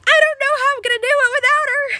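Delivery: high, loud mock-tearful wail; imitating crying friend. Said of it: "she keeps crying".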